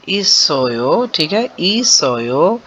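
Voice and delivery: इसोयो said very slowly with a rise; slow repeat. Shown as a demonstration. Teacher repeating the recorded word slowly for students. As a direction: drag out इसोयो carefully. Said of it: "आप इसे सुने वो क्या पढ़ता है".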